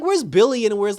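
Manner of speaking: high, loud puzzled outburst; baffled question. Retold or imitated. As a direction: demand an answer in disbelief. Confused fan asking about Shazam family.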